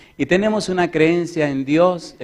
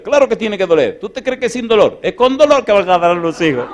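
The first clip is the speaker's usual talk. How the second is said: raised, preachy voice, a little higher; preaching a sermon; 6 semitones higher, 5 dB louder than usual. Retold or imitated. Husband preaching to his wife in labour, raised preachy tone. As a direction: lecture her like a preacher from the pulpit. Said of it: "Le prediqué un sermón ahí".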